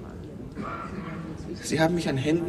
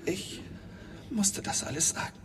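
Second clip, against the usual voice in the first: barely audible, voice giving out; broken near-whisper; 2 semitones higher, 12 dB quieter than usual. Acted. Witness admitting forced confession to the court, near-whispered. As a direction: whisper it as if crushed by what was done to him.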